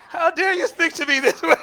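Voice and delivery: high, loud, laughing mock fury; mock indignation. Grandfather pretending outrage at his grandson, laughing. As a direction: play the offended elder, laughing. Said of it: "And I'm like".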